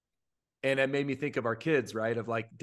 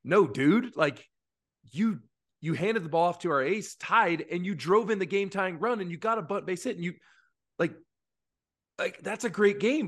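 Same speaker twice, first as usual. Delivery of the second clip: insistent encouragement; 6 semitones higher than usual. Retold or imitated. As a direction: pump the boy up, he played great. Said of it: "it's like".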